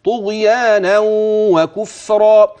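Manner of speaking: طغيانا وكفرا slow, tanween merged with a nasal hum; slow recited طغيانا وكفرا; merging with ghunna. Shown as a demonstration. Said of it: "هكذا".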